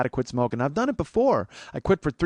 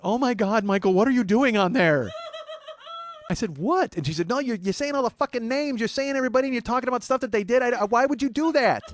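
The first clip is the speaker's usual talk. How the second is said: much higher, louder mock mother, alarmed; mock upset mom; 12 semitones higher, 6 dB louder than usual. Mom scolding her son over the podcast, high loud mock voice. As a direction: play the horrified mom, pitched way up.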